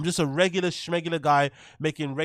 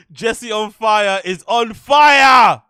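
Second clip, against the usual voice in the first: hype shout; 4 semitones higher, 11 dB louder, much slower than usual. Pretend. Hyped chant of the name.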